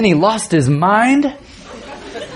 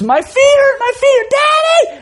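put-on very high-pitched, escalating kid shriek; child screaming. Son calling Daddy from the bathroom, screaming harder. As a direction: imitate a boy screaming for his dad. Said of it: "the intensity is picking up".

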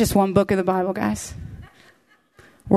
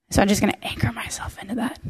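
whispered, hushed resolve; quiet resolve; 5 dB quieter, faster than usual. Retold or imitated. Joseph quietly resolves to trust God, whispering. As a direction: drop to a soft, unvoiced hush.

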